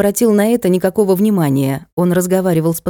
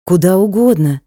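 usual speaking voice

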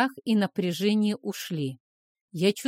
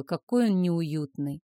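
ordinary delivery

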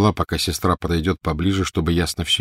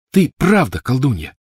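put-on earnest insisting child; 7 semitones higher, 5 dB louder than usual. A narrator's reading. Boy earnestly convinces girl in story.

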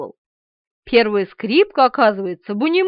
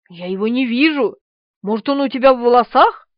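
ordinary delivery

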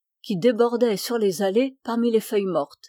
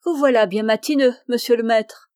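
appel lancé fort et vite; héler de loin, haut et rapide; crié; 2 semitones higher, 6 dB louder than usual. Acted. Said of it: "cria quelqu'un". (crié fort et vite, avec entrain)

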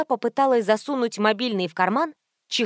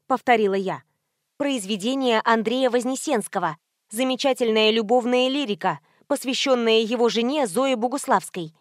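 plain reading voice

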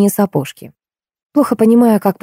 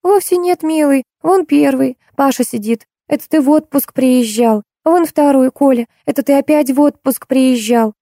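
high-pitched, placating feminine figure; joke wife; 8 semitones higher than usual. Acted. Joke wife explaining children to her husband, high innocent voice. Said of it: "А жена в ответ".

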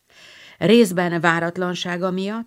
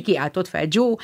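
ordinary delivery, nothing marked

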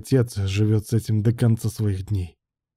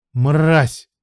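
louder, raised, angry outburst; furious insult; 5 semitones higher, 5 dB louder than usual. A narrator's reading. Betrayed man to his partner, furious.

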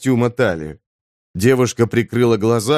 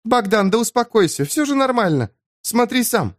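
female character voice; 11 semitones higher than usual. Acted. Eva calming Bogdan.

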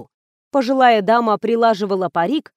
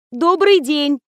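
higher, raised greeting; 5 semitones higher, 4 dB louder, slower than usual. A narrator's reading. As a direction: try to get through to someone hard of hearing. Louder greeting to deaf old woman.

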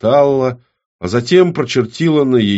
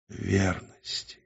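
slow, very soft echo; subdued repetition; 10 dB quieter, slower than usual. A narrator's reading. Woman repeating the oath after the demon, subdued. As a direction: repeat it faintly, reluctantly. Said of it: "Она должна была повторять".

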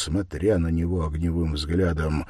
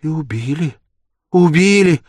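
high woman's cry, growing louder; anguished reproach; 10 semitones higher, 11 dB louder than usual. A narrator's reading. Sonya reproaching the murderer, anguished. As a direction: cry it out, the repeat higher and louder.

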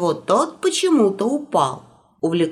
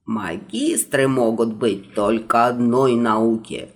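put-on stern old magister; 8 semitones lower, slower than usual. Stern magister, then Ivan.

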